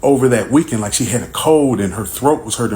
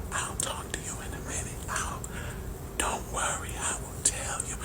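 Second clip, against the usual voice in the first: voiceless, much softer whisper; 19 dB quieter than usual. Retold or imitated. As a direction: imitate the wife whispering to dodge the talk. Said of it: "And so she's whispering".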